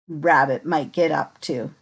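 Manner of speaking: ordinary delivery, nothing marked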